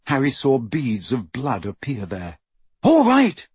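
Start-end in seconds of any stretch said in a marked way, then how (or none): 2.8-3.5 high, loud shout. A narrator's reading. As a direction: shout it in surrender. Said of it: "he shouted".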